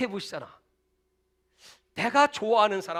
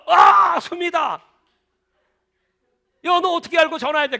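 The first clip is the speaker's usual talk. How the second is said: much louder, higher surprised shout; shocked exclamation; 8 semitones higher, 9 dB louder than usual. Retold or imitated. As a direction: shout in disbelief at the phone. Surprised call after years.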